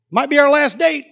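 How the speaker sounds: high, loud comic outburst; punchy acted exclamation; exclaimed retort. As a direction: burst out with it for the story. Retold outburst at the table.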